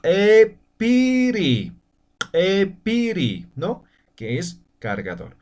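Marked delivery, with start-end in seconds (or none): 0.0-3.5 epiri stretched out very slowly, syllable by syllable; very slow pronunciation demo. Shown as a demonstration. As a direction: draw out epiri for learners. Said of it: "la pronunciación va a ser".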